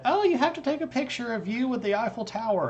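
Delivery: high, eager retold friend's plea; imitating friend. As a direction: play the pushy friend begging. Friend urging photo, retold.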